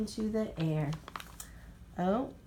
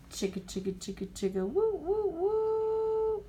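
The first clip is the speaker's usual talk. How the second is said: choo-choo train sound, chug then whistle; train chugging and whistle; 10 semitones higher than usual. A narrator's reading.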